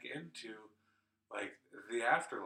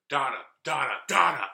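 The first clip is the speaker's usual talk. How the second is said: loud, insistent calls, building higher; imitated yelling; 5 semitones higher, 15 dB louder than usual. Someone yelling mom's name to wake her up, imitated loud yell. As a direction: yell the name harder each time. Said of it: "someone yelled in my face, yelled my name".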